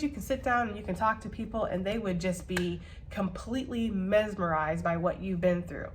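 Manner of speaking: ordinary talk, nothing marked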